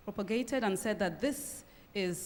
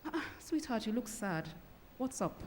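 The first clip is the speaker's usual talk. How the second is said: higher, softer, gentle sweetheart; tender empathy; 4 semitones higher, 5 dB quieter than usual. Shown as a demonstration. Spouse showing empathy to a sad partner, tender. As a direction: coo it softly to a sad spouse.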